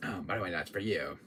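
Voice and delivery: ordinary talk, nothing marked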